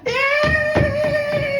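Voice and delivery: high, loud yay; boy whooping as he runs downhill; gleeful cheer. Acted. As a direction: shriek with childish joy.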